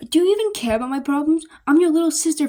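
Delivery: whiny guilt trip. Acted. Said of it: "Stella looks upset".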